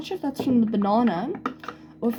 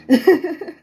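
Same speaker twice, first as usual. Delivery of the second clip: loud high laugh; real loud laugh; laugh at own joke; 8 semitones higher, 10 dB louder than usual. Real, not acted. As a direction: burst out laughing at your own pun. After her bananas joke.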